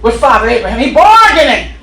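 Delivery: whooping preacher at full volume; preaching at full force. Acted. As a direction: preach it with rising fire.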